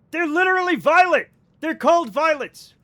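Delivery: setting chat straight, fed up; exasperated insisting. Real, not acted.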